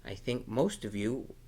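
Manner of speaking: plain reading voice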